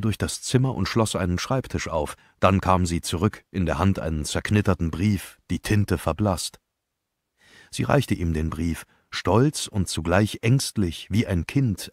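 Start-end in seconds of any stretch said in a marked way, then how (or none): none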